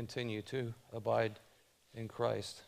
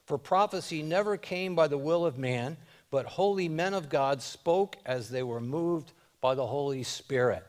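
loud, high preaching of the verses; fervent scripture reading; 5 semitones higher, 8 dB louder than usual. Acted. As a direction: proclaim the scripture with full force. Reads scripture at full preaching force.

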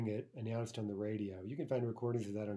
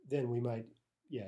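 ordinary talk, nothing marked

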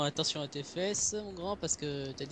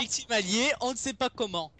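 mock announcer; 7 semitones higher, 6 dB louder than usual.

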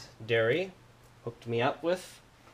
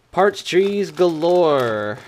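excited; 6 semitones higher, 10 dB louder, much slower than usual. Real, not acted. Excited reveal during the unboxing.

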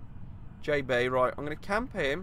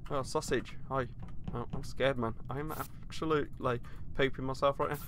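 ordinary talk, nothing marked

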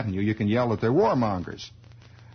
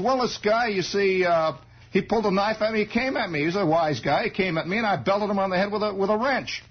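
Garfield explaining; 10 semitones higher than usual. Retold or imitated. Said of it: "He says".

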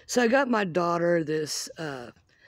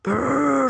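shivering brrr; 6 semitones higher, 6 dB louder than usual. Real, not acted. It's cold.

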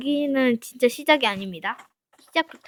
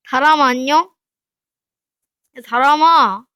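loud, high calls to the squirrel; excited calling to a pet squirrel; 4 semitones higher, 11 dB louder than usual. Real, not acted. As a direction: shout its name across the map.

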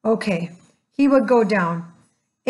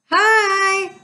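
high, louder call; 9 semitones higher, 4 dB louder than usual. Acted. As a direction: call out to the creature below. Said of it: "Annie called again to the creature".

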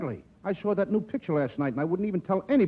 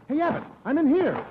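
calling out; 9 semitones higher than usual. Acted. Calling out to Abbott.

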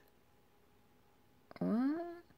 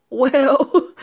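loud surprised well; 9 semitones higher, 12 dB louder than usual. Real, not acted. Reacting to the alpha's reply.

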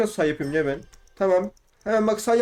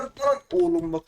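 much higher, slow, stretched whine; strange high whine; 11 semitones higher, slower than usual. Real, not acted. Player outraged at an obstacle, slow high whine. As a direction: let it squeak out long and high. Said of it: "çok değişik sesler çıkartıyorum".

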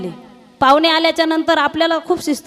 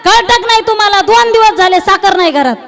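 shouting servant; 6 semitones higher, 7 dB louder than usual. Retold or imitated. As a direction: bellow the news to the whole gathering. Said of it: "एवढ्या लोकांमध्ये ओरडून म्हटला".

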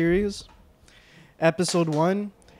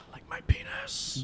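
high breathy whisper; 8 dB quieter, slower than usual. Acted. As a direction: whisper it like a secret joke. Whispered joke aside.

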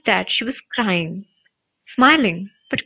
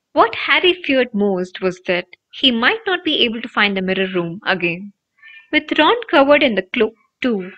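ordinary delivery, nothing marked

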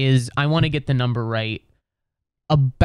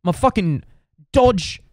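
high-pitched British grumble, punching the key word; indignant British bloke complaining to his mate; hammed-up British outrage; fuming British dude; 5 semitones higher than usual. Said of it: "He just kept saying".

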